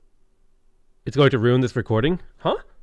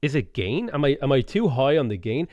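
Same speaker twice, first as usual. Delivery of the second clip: ordinary talk, nothing marked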